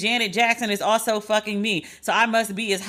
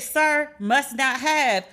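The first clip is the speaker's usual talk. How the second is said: slow quoted jab; 3 semitones higher, slower than usual. Retold or imitated. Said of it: "But I said".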